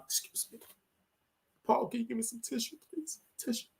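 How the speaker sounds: soft, teary request for a tissue; hushed, tearful aside; holding back tears. Real, not acted. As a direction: choke it back and ask quietly. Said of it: "getting emotional".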